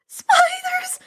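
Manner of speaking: very high, loud panicked cry; terrified cry. Acted. Annabeth seeing the spider robots, terrified shriek. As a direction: shriek it in terror. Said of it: "Annabeth cried".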